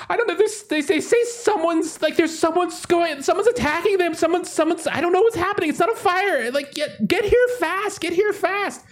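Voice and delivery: panicked hotel worker. Acted. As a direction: babble in panic, begging for help. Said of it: "one of these workers be like".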